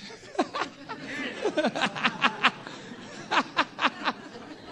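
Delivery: high, long breathy burst of laughter; cracks up mid-story, can't hold it in. Real, not acted. Preacher to the congregation, a high laugh.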